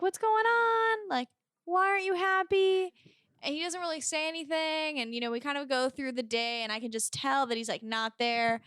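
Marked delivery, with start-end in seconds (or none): 0.0-2.9 put-on worried girlfriend. Girlfriend asking her sad boyfriend on her birthday, high and pleading. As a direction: coax him, puzzled and worried. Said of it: "And I'm like".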